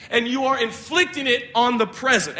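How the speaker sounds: usual speaking voice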